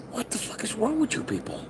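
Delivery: ordinary delivery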